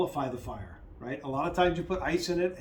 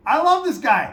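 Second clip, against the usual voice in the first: higher and louder, a gushing squeal; gushing quote; 10 semitones higher, 12 dB louder than usual. Retold or imitated. Retelling his first crush on Curtis, excited squeal. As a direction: blurt out your man crush. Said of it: "I was like".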